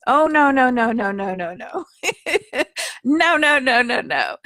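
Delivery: giggling, high playful protest; mock panic with a laugh. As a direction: laugh through a flustered refusal. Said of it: "I say that with, um, a gentle laugh and some humor".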